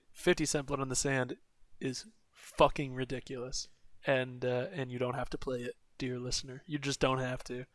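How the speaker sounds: soft confiding aside; quiet aside. Real, not acted. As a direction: lean in and tell the listener. Aside to dear listener.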